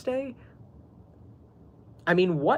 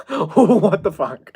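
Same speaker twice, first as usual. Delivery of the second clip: laughing disbelief; 3 semitones higher, 8 dB louder, much slower than usual. Retold or imitated. Reacting to creepy dream message.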